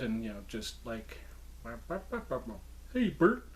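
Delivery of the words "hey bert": high-pitched puppet character voice. Pretend. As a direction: give the puppet a higher, playful voice. Working the puppet's head, giving it voice.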